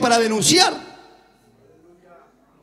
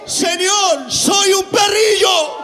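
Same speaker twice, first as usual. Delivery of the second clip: pleading woman; 4 semitones higher, much slower than usual. Acted. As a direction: cry out humbly, drawn out and high like the begging woman. Preacher voicing the humbled woman.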